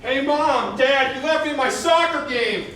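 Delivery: put-on high, loud furious child; angry yell. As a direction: yell at the parents, fuming. Angry child bursts in yelling.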